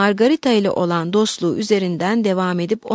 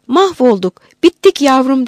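loud, raised cry of ruin; alarmed despair; 4 semitones higher, 7 dB louder than usual. A narrator's reading. Abbess to Margarita, stranded for the night, alarmed lament. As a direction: cry out as if all is lost.

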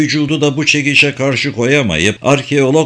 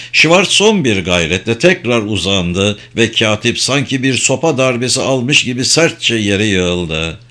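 usual speaking voice

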